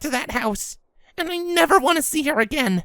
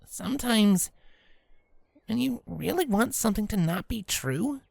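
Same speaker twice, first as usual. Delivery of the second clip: gentle Rainbow; 8 semitones lower than usual. Acted.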